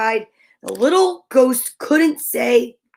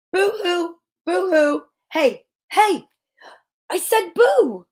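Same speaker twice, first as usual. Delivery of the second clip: shouted; 6 semitones higher than usual. Acted. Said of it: "he shouted".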